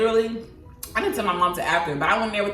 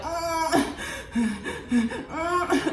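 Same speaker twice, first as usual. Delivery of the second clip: high strained hum through closed lips; pushing grunt; 11 semitones higher than usual. Retold or imitated. Retelling labor, she hums a high strained push. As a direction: mimic straining with the last breath.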